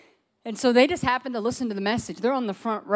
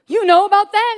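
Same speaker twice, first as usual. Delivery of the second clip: high, loud, laughing disbelief; surprised laugh; 11 semitones higher, 11 dB louder than usual. Retold or imitated. Imitating him, startled he knows the story, high laughing. Boyfriend surprised at the family word.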